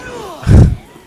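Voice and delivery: shrieking burst of laughter; loud high laugh. Real, not acted. Gamer laughing loud at his own dark joke. After joke about bodies.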